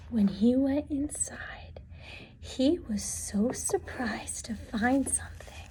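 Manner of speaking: soft suspenseful whisper. A narrator's reading. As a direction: hush it like a secret. Suspense before the discovery.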